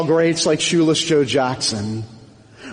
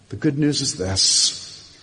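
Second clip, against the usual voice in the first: usual speaking voice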